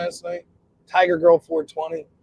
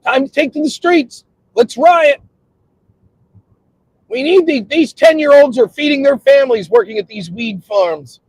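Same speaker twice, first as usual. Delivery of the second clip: loud, high agitator's call; rally the crowd in mock outrage; mock rallying cry; 8 semitones higher, 6 dB louder than usual. Mock agitator calling people to riot, loud and indignant.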